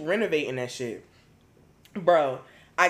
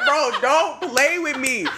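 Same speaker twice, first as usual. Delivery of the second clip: laughing warning; 9 semitones higher, 4 dB louder, slower than usual. Retold or imitated.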